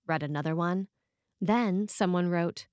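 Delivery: ordinary talk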